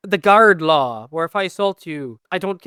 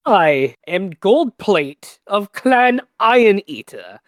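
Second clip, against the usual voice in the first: insulted noble. Acted. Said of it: "like an insulted noble".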